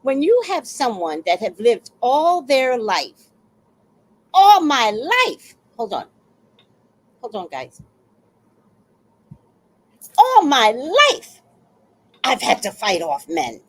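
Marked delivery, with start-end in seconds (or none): 4.3-5.6 "all my life" loud, high and stretched; emphatic declaration. Real, not acted. Woman telling viewers of fighting off men, loud stretched emphasis. 10.2-11.4 "All my life" belted high and slow; emphatic declaration. Real, not acted. Woman repeating her point to viewers, loud drawn-out emphasis.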